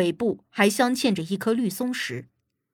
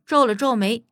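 usual speaking voice

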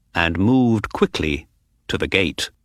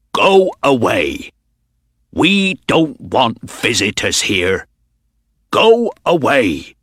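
angry shout. A narrator's reading. Stableman chasing visitors away, angry shout. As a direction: bark at the strangers to get out. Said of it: "He had a red, angry face".

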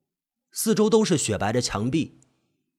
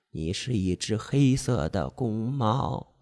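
slow, soft hypnotic chant; seductive hypnotic voice; 6 semitones lower, 5 dB quieter, slower than usual. Acted. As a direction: lull him, stressing 黑 softly. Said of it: "魅惑的声音， 细声慢语".

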